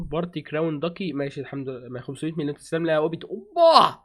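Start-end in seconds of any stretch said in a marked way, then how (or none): 3.3-4.0 high, loud surprised "اوبا"; surprised whoop. Real, not acted. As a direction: yelp it out in sudden delight. Big gift pops up.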